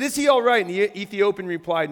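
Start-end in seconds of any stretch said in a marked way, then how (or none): 0.0-0.6 higher, louder urgent question; demanding. A narrator's reading. King asking if his son is safe, demanding. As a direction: press for an answer. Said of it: "the king demanded".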